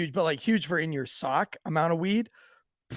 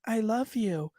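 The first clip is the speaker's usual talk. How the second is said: softer, higher imitation of a child; little girl; 4 semitones higher, 6 dB quieter, slower than usual. Little girl answering at church, small soft voice. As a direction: lightly voice a small, sweet child. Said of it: "this one little girl".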